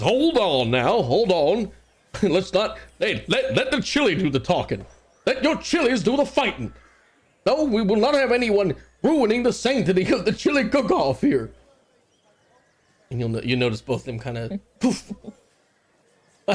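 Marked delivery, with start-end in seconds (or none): 0.0-11.5 constable breaking up a fight. Acted. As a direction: step in firmly to stop the scuffle. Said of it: "the constable rushes forward and says".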